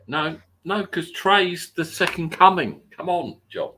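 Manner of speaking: high, loud hype-man voice; excited hype. Real, not acted. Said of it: "Build it up".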